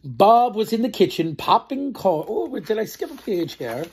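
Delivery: slow, clear storybook narration; reading to children. A narrator's reading. Storyteller narrating the farm tale to children. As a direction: read it out slowly for little ones.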